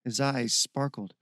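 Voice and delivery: plain reading voice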